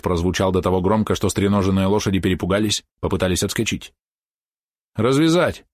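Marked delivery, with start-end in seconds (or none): 4.9-5.6 sharp command. A narrator's reading. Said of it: "велел Розмич". (громкий приказ)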